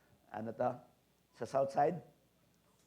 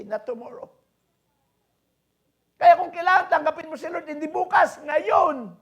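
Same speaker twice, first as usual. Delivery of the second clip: loud, high preaching call; 18 dB louder than usual. Acted. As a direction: urge them to act now. Preaching at full force. (malakas na pangangaral)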